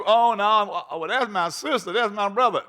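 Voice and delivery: lightly voiced defensive churchgoer; mock excuse. Preacher imitating someone excusing their relatives, mock.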